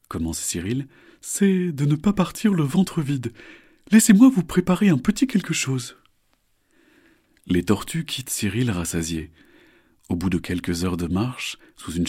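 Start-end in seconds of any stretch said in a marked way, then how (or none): 1.2-6.0 high, cheerful crocodile; friendly crocodile voice. Acted. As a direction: greet them like a charming croc. Cyril offers food.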